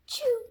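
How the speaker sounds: high sharp chew of a sneeze; sneeze release. Acted. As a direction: snap out the end of the sneeze.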